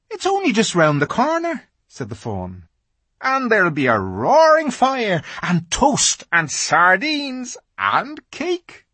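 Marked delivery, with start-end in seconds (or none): 0.1-1.6 fussy fawn. A narrator's reading. Tumnus coaxes Lucy. 3.2-8.8 fussy fawn. A narrator's reading. Tumnus tempts Lucy.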